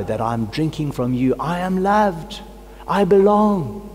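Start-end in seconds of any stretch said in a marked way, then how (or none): 1.4-3.9 slow, higher, savoring each phrase; a pastor praying aloud, deeply moved; heartfelt affirmation. Real, not acted. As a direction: let it land as a gift. Savoring receiving God's love.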